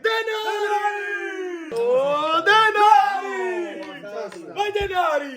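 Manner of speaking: high, drawn-out race cheer; excited cheering. Real, not acted. Cheering on the Denari horse, high excited shouts.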